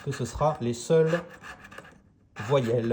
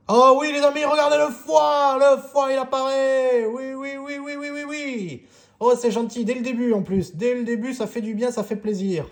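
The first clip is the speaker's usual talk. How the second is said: very high, loud excited shrieking; over-the-moon cheering; thrilled squeal; 12 semitones higher, 6 dB louder than usual. Real, not acted. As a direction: squeal with real joy at the win. The winning symbol finally appears.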